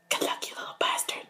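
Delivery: unvoiced, soft sneering whisper; whispered insult.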